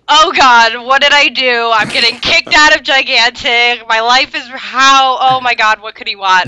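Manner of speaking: loud, high panicked mock reaction; imitating his own alarmed self, loud and high. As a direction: ham up the dread of being in trouble.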